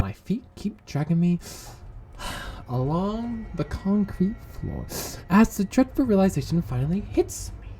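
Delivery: soft, dragging dramatic narration; hammed-up gloomy hush; weary dread. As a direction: narrate your doom quietly, with a sigh. Gloomy story-style narration.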